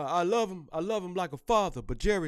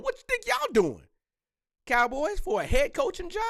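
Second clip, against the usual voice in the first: incredulous outburst; 14 semitones higher, 3 dB louder than usual. Real, not acted. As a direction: pitch jumps way up in disbelief. Mocking Cowboys for courting his coach.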